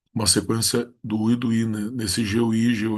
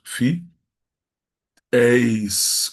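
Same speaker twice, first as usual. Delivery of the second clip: Fi, eis with a long pause between syllables; syllables separated; 4 dB louder than usual. Shown as a demonstration. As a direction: split "Fi, eis" and hit eis loudly. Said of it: "o E tá no final".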